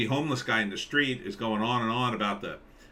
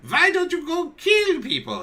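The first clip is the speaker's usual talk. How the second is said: much higher, loud, squeaky cartoon dog; a silly dog urging murder, pitched way up; German Shepherd dog voice; 7 dB louder than usual. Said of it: "he would say".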